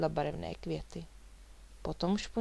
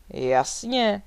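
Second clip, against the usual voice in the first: loud, proud confirmation; full-voiced, self-assured reply; proud exclamation; 8 dB louder than usual. A narrator's reading. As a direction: call it out with pride. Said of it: "zvolal Vladimír hrdě".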